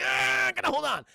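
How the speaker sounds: high, loud straining cry; hammed-up desperate yelp; mock panicked strain. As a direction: squeal it while clinging on for dear life. Robin clinging to the building.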